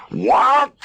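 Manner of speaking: high, loud shocked scream. A narrator's reading. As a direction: shriek it in disbelief. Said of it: "screamed Rhubarb".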